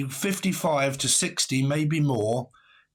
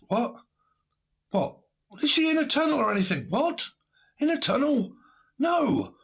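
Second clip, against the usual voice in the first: mock disbelief; 11 semitones higher than usual. Mock incredulous replies.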